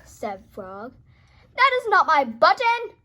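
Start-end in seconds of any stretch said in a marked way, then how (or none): 1.6-2.9 loud, indignant shout. Acted. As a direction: shout it at Frog in frustration. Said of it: "shouted Toad".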